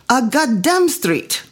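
slow, a hint of Italian accent; mild Italian grumble. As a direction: say it flatly in light Italian. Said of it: "His Italian accent was so thick".

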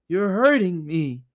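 high, whining ghost skull; whiny skull voice. Acted. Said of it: "said the skull".

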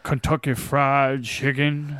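slow, stretched Southern drawl; mock Southern accent, very slow; Kentucky-fried Southern drawl. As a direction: drag it out like a Southern detective. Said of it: "He has that, like, Kentucky Fried Chicken".

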